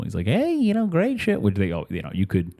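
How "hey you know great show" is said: high-pitched, chirpy greeting; cheerful casino guy. Retold or imitated. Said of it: "He's very, like, cheerful".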